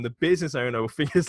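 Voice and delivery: ordinary talk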